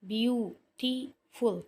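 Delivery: Beautiful very slow, three separate syllables. Shown as a demonstration. Teacher showing the syllables, beautiful slowly divided. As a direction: break beautiful into three drawn-out beats. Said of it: "इसके total तीन syllable बने हैं".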